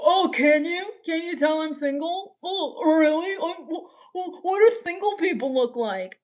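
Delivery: high-pitched sarcastic questioning; mock surprise. Streamer answering a chat comment about looking single, mock-offended. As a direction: feign wide-eyed disbelief, pitched high.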